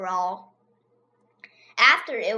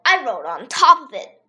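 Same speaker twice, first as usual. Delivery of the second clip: usual speaking voice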